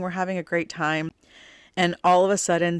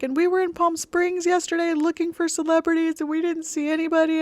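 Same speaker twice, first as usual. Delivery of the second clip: mock bawling; 12 semitones higher than usual. Daughter sobbing to mom about Dad and celebrities, high wailing voice. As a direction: play the daughter bawling over Dad, much higher and wailing. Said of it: "immediately started bawling".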